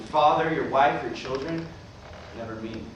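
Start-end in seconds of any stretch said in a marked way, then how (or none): 2.4-2.8 hurt. Acted. Hurt brother left out.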